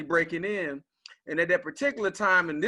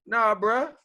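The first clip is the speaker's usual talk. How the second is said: higher, emphatic retold reality check; setting son straight; 7 semitones higher, 3 dB louder than usual. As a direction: voice a father correcting his naive son. Father setting his son straight.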